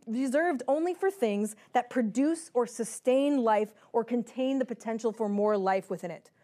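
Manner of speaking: normal talking voice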